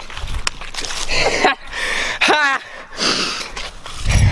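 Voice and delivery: unvoiced breathy laugh; breathy laughter. Real, not acted. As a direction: laugh off the prank. Laughing after prank.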